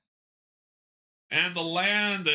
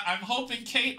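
ordinary talk

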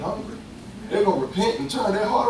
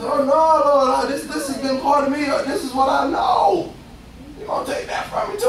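higher, half-shouted plea; pleading with the Lord; 7 semitones higher than usual. Retold or imitated. Young rapper pleading with the Lord to keep his old life, mock distressed. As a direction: beg God not to take it away. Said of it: "I said".

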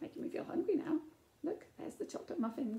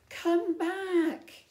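much louder call with higher pitch; shouting; 6 semitones higher, 12 dB louder than usual. Acted. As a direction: yell after the fleeing princess. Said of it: "shouted Queen Stephaness".

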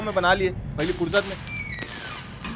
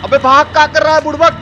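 loud, higher urgent shouts to run; frantic yelling; 5 semitones higher, 6 dB louder than usual. Real, not acted. Gamer yells at his character to flee, frantic shout. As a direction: holler at him to get moving.

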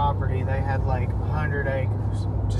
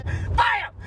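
gunshot. Retold or imitated. Imitating the gunshot.

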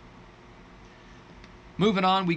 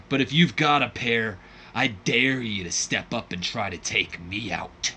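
promo character voice. Acted. War hero on the mic daring Guillotine Gordon, taunting. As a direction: throw down the dare to step into the ring. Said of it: "I broke character".

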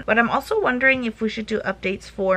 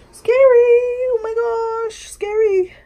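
very slow, high, loud wail; scared wail; 13 semitones higher, 7 dB louder, slower than usual. Real, not acted. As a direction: wail it in fright.

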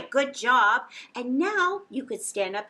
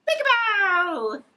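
higher, louder, drawn-out peekaboo; peekaboo reveal; 8 semitones higher, 10 dB louder, slower than usual. Shown as a demonstration. Showing the kids the peekaboo game, big and high. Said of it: "this is how it goes".

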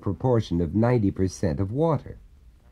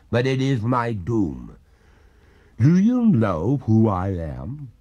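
lofty, doomed spirit explaining; ghost voice; 2 semitones higher than usual. Acted. Ghost lectures the master.

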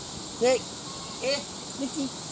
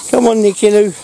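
higher and louder dog call; 5 semitones higher, 6 dB louder than usual. Real, not acted. Owner calling the dog Nick, higher and louder. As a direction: sing out to the dog to come.

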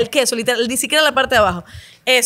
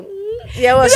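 delighted food moan; 9 semitones higher, 4 dB louder than usual. Shown as a demonstration. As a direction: show the happy eating noise. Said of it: "yo hago como que".

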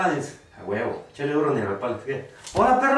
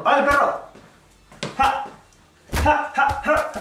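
hyped taunt; 8 semitones higher than usual. Real, not acted. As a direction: hype yourself up against the opponent. Hyped taunting in boxing game.

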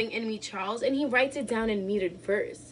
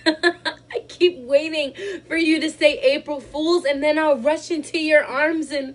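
loud, high, laughing and breaking; laughing through tears; 6 semitones higher, 10 dB louder than usual. Acted. To her husband, high loud laughing through tears. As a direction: laugh it out as it falls apart.

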